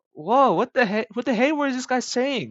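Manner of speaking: high, loud disbelief, acted out; baffled. Coffee shop owner hearing an unknown language, baffled. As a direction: play an owner thrown by what was just heard.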